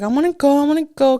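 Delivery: put-on high, pleading little boy; begging kid voice. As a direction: beg like a small kid who wants in. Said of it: "he was like".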